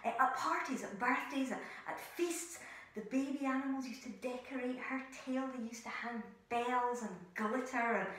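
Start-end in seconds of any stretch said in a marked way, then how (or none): none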